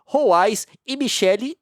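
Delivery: normal talking voice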